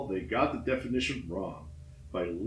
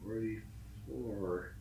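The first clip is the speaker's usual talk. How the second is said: much softer and very slow, almost gone; hopeless murmur; 11 dB quieter, much slower than usual. Acted. Trapped climber seeing the same number again, defeated murmur. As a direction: breathe it out like giving up.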